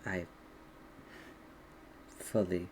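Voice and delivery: soft, low, halting confession; confessing dragon. Acted. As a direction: confess quietly, with long hesitant pauses. Game master plays confessing dragon.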